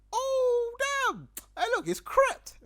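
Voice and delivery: high, loud cry of recognition; hammed-up shock at a celebrity sighting; starstruck exclamation. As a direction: blurt it out loud and high on spotting a star. Spots a famous rapper.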